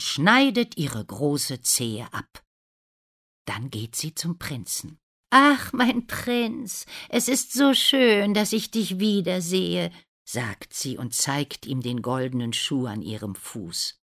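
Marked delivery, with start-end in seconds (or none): 5.3-9.9 gushing stepsister. A narrator's reading. Rosa greets the prince wearing the shoe, gushing high. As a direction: gush sweetly in a high voice.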